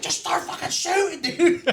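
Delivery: high-pitched frantic order, half laughing; urgent mock yell. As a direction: imitate yelling at your gunner under fire. Retold order to laughing gunner.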